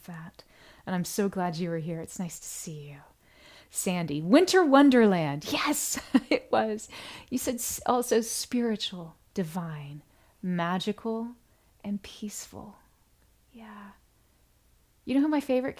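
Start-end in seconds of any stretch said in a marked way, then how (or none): 2.0-3.0 whisper. Real, not acted. Greeting viewers on livestream. 11.8-13.9 whisper. Real, not acted. Reading viewer comments.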